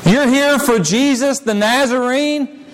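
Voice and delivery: ordinary delivery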